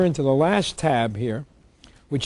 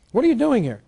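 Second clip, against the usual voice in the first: higher indignant challenge; 8 semitones higher than usual. Retold or imitated. Taxpayer confronting police at door.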